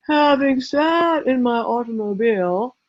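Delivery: stiff, slow, put-on writerly voice; slow stilted literary voice; phony voice. Shown as a demonstration. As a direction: read it out pompous and fake. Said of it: "you got this phony voice".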